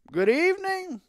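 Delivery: high, sing-out greeting; high-pitched show of cheer; forced cheerful. A narrator's reading. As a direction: cry out a greeting, trying to be cheery. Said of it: "trying to sound cheerful".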